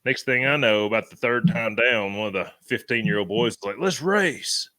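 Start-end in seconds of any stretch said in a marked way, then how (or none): 3.8-4.7 imitating a boy's raised-pitch dare; eager challenge. Teenage boy dares the others downhill, eager challenge. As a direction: toss out a keen challenge, pitched up.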